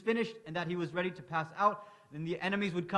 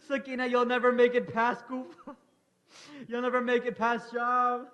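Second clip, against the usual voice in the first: higher and louder, breaking into sobs; choked grief; 4 semitones higher, 5 dB louder than usual. Acted. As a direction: say it through tears. Father answering weeping daughter.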